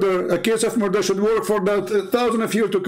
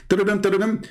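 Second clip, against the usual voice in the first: sing-song ring tone of a phone; imitated phone ringing; 2 semitones higher, faster than usual. Calling Zakir Naik on a pretend phone, mock ringing. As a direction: mimic a phone line ringing out. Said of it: "See how fast the ringing?".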